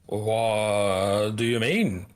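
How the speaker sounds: long drawn-out, puzzled question; baffled guard. Acted. Guards look back at the speaker, slowly baffled. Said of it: "they're like".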